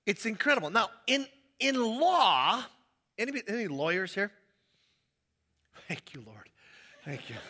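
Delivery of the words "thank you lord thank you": very soft, low aside of thanks; mock prayer. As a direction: murmur pretend relief to God, a laugh creeping in. Mock relief that no lawyers came.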